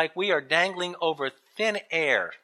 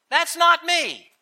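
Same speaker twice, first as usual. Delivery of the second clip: high, slow objection; protesting; 9 semitones higher, 4 dB louder, slower than usual. Acted. Reluctant listener objects to leaving comfort zone, protesting. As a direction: push back emphatically.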